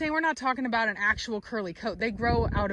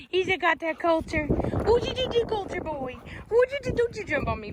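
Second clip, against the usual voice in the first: much higher teasing coo; high puppy talk; 7 semitones higher than usual. Real, not acted. Breeder teasing her puppy in high baby talk.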